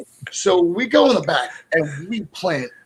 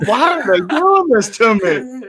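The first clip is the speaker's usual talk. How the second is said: higher mock panicked whine; 6 semitones higher than usual. Recalling fear during the match, mock panic. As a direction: whine it like a scared rookie. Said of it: "I was like".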